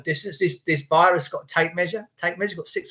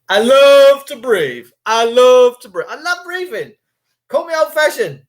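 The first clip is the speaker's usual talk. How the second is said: declaring love of breathing; 6 semitones higher, 6 dB louder than usual. Acted. Defiant declaration about breathing.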